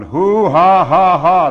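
very loud, high hoo-ha-ha; booming acted laughter. A narrator's reading. Narrator acts Bisou laughing with waving arms, booming. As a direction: let the laugh roar out.

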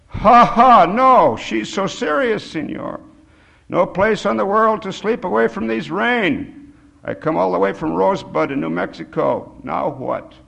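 high plea, starting loud and settling; tearful old Mexican. A narrator's reading. Old man laments having nowhere to sleep, high and pleading.

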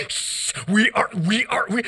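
ordinary talk, nothing marked

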